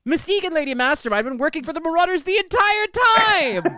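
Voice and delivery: spring the betrayal like a gasp-worthy reveal; excited reveal. Real, not acted. Podcast host revealing the twist to co-hosts, excited. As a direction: voice jumps high with the twist.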